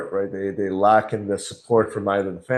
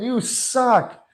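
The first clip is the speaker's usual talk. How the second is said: very high, jeering put-down; mock insult; 14 semitones higher than usual. Imagining insulting another person, mock jeer. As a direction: throw a pretend insult, pitched way up.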